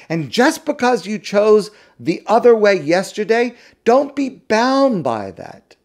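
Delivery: high, fervent preaching. Acted. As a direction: drive home the message, pleading upward. Impassioned preaching climax.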